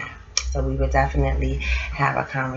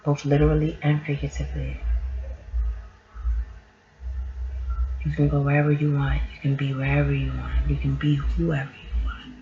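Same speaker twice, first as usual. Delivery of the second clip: guided meditation; 3 semitones higher, 4 dB quieter than usual. Acted. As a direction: guide them gently into calm. Leads a mindful moment.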